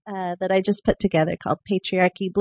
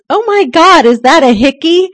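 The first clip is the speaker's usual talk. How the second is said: high, loud gasp of a question; shocked Kate; 9 semitones higher, 11 dB louder than usual. Retold or imitated. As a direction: blurt it out, caught off guard. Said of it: "and Kate says".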